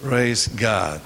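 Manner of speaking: ordinary talk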